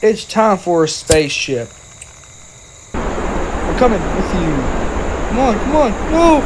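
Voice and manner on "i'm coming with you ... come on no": desperate cry. Pretend. Character chases departing spaceship.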